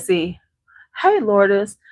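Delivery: usual speaking voice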